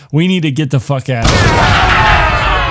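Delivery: loud, slowed, pressing shout; urgent shout. Retold or imitated. Luis urging Bjorn to leave the house now, urgent shout. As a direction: push him out the door with your voice.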